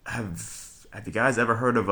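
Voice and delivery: ordinary talk, nothing marked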